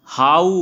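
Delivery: हाउ loud, gliding into a long "oo"; stretched ending. Shown as a demonstration. Teacher giving another example, हाउ drawn out loudly. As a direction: press on the final उ of हाउ. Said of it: "stretch हो रहा है".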